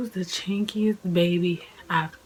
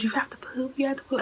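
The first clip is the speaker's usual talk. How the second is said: whispered, pitched up and urgent; a nurse friend whispering urgently at the bedside; 6 semitones higher, 5 dB quieter, faster than usual. Retold or imitated. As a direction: hiss it close to her ear, pressing. Kat urging during labor.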